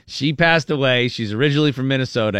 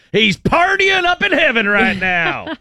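slow, high and giddy; hammed-up cheerful relative; high happy guy voice; 13 semitones higher, 4 dB louder, slower than usual. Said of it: "the guy from Union City last week who was like".

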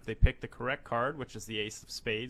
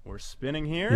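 game show host; 5 semitones higher, 6 dB louder than usual. Acted. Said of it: "You're the game show host".

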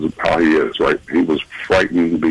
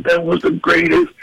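higher awed excitement; 12 semitones higher than usual. Retold or imitated. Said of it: "He's like".

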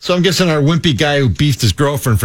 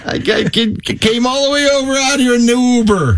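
mock indignant complaint; 8 semitones higher than usual. Side chick griping about the Uber trip, mock indignant. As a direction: whine it indignantly, pitched well up. Acting out the angry woman's message.

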